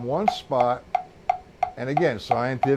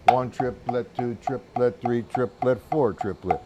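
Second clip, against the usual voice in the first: slow, metronomic triplet count, stepping down at the end; rhythmic counting; 4 semitones lower than usual. Shown as a demonstration. Drum teacher showing how most guys count triplets, rhythmic counting. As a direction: count evenly on the beat, syllable by syllable. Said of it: "Most guys go".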